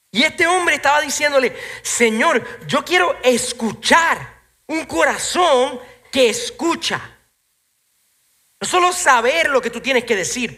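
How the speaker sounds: high, loud preacher's challenge; preaching at full force. Real, not acted. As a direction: press the congregation with the question. (predicando con fuerza, voz alta)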